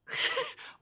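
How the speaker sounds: a quick airy laugh; breathy laugh. Real, not acted. Streamer laughs at the coworker's description, airy. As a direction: let a short laugh escape.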